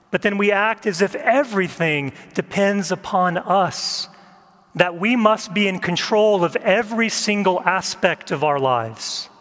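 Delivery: usual speaking voice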